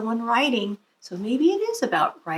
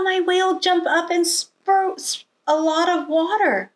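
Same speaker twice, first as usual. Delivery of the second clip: put-on bright young Emily; 6 semitones higher than usual.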